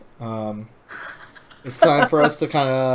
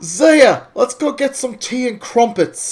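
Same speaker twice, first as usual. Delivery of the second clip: much higher, louder jokey British voice; mock British accent; 11 semitones higher, 5 dB louder than usual. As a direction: play a posh Brit inviting a friend. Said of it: "impression of my accent".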